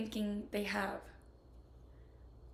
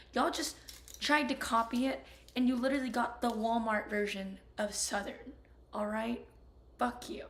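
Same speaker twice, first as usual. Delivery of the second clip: heated rant; 5 dB louder than usual. Real, not acted. Defending South Carolina as Southern.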